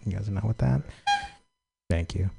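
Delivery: ordinary delivery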